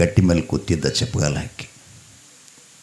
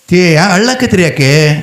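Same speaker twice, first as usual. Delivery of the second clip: high, raised call of concern; concerned questioning; 9 semitones higher, 4 dB louder than usual. Acted. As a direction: ask the crying couple, voice lifted high. Siddappa questions the weeping couple. (ಕಳವಳದಿಂದ ಏರುದನಿಯ ಪ್ರಶ್ನೆ)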